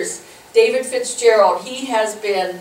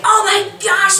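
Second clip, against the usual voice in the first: alarmed exclaim; 10 semitones higher, 4 dB louder than usual. Retold or imitated. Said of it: "running up and down saying".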